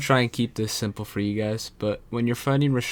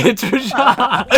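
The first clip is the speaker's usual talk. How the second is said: very high, loud laughing shout; hysterical excitement; 8 dB louder than usual. Real, not acted. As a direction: shriek it through laughter. Overexcited at meeting the boss.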